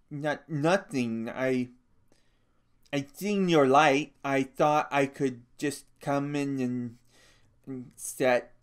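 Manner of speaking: anxious to please, tripping over words; simple-minded big man. A narrator's reading. Lennie telling Crooks why he came, nervous and halting.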